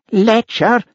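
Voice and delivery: very high accusing howl; shrill howled accusation; outraged howl. Acted. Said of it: "howled Nessie".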